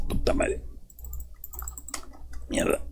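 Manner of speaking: muttered curse, unvoiced; cursing under breath. Real, not acted. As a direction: swear quietly to yourself. Cursing quietly at game.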